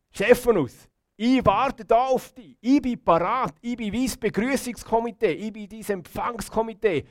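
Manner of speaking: high, ringing call of welcome; exuberant welcome. Acted. Jesus receiving Stephanus in heaven, exuberant welcome. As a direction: proclaim it like a personal greeting party.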